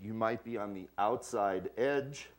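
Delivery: normal talking voice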